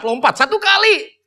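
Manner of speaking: ordinary talk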